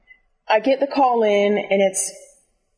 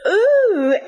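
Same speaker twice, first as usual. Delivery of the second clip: a high, louder cooing ooh; imitating the recorded whisper; 9 semitones higher, 3 dB louder than usual. Phone worker imitating the sexy pre-recorded whisper, high coo. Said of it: "That's what the whisper sounds like?".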